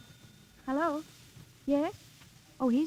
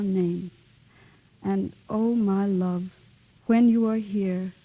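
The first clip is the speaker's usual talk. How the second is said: slow, measured love speech; romantic recitation; 4 semitones lower than usual. Acted. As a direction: recite it tenderly, taking your time.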